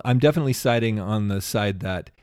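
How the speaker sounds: ordinary delivery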